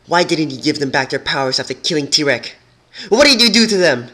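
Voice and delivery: rising to a high, loud yell; accusing demand. Acted. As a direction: press the questions harder until you yell. Unicorn pressing her prison wall.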